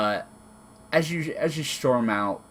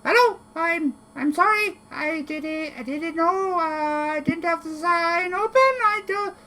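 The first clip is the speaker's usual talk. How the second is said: very high, squeaky little kobold voice; a flustered tiny kobold caught off guard; nervous squeaky kobold apologizing to an intruder. Acted. GM voices timid kobold shopkeeper.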